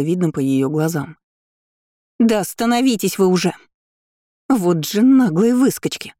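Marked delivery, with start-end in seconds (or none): 2.2-6.1 indignant yell, moderately raised and higher; angry shout. A narrator's reading. Haughty girl yelling at classmates who keep walking, mildly angry shout. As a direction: stretch out the insult, calling after them crossly. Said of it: "Вновь закричала нам темноволосая".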